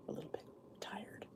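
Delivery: tired, breathy near-whisper; sleepy whisper. Real, not acted. Vlogger to viewers, apologizing for being tired, whispered. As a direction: fade into a worn-out whisper. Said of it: "I'm not my perky self because I'm just".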